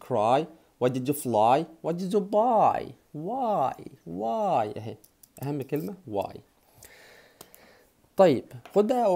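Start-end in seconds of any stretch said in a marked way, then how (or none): 1.8-4.8 slow and higher; slow teaching. Shown as a demonstration. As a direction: stretch it for learners. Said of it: "Why did you lie؟".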